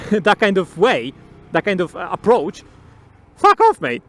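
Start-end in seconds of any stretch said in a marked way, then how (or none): 3.4-4.0 loud, high-pitched brush-off; dismissive outburst. Acted. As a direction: snap it out loud at a pushy stranger. Acted rebuff to demanding YouTuber.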